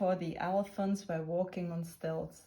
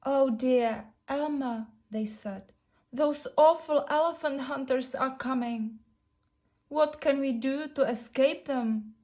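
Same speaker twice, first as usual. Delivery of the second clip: raised, louder pleading worry; worried elephants; 6 semitones higher, 6 dB louder than usual. Acted. Frightened elephants warn Elmer about hunters, raised worried pleading. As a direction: fret aloud about the coming hunters. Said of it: "They were looking very worried".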